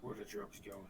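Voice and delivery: low, quiet gravel growl; Batman voice. Retold or imitated. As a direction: imitate Batman, deep and hushed. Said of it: "He's doing a Bat-, dark, a Batman Begins voice".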